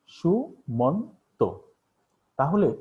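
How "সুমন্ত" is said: সুমন্ত split slowly into three beats, su-mon-to; syllable by syllable. Shown as a demonstration. Teacher splitting সুমন্ত into three syllables for students. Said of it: "এখানে তিনবার আছে".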